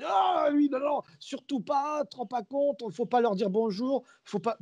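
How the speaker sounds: high, hushed, urgent warning; panicked plea to stay away; alarmed warning. Retold or imitated. Colleague warning him off Ric Flair, hushed and alarmed. Colleague warns not to approach.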